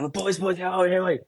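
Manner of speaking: high, rowdy mock hello to the boys; calling out to the boys after the win, lightly acted; excited greeting. Rowdy greeting in rooms after game.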